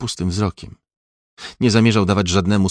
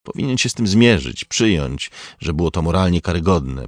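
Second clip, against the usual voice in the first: usual speaking voice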